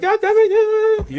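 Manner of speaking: high, loud mock yell of a frustrated coach; imitating a coach yelling. Coach yelling at the player who ran the wrong play, high loud mock yell. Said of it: "Money kept being like".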